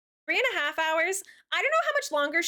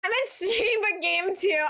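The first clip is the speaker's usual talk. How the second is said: laughing; 6 semitones higher, 3 dB louder, slower than usual. Real, not acted. Laughs while reading.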